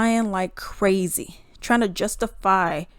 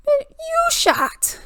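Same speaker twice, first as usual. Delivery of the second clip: incredulous retort; much slower than usual. Real, not acted. Scoffing at the cop's claim.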